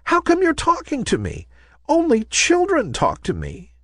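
small, very high puzzled voice; surprised. A narrator's reading. Ballerina puzzled by a talking adult, surprised. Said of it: "said the ballerina in surprise".

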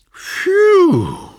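loud high whistled phew; whistling phew. Acted.

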